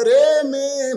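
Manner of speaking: high call, loud at the start; preacher acting out a shouted reply; excited shout. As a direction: shout out to the girl, then ease off. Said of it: "সঙ্গে সঙ্গে হোসেন চিল্লায় বলে".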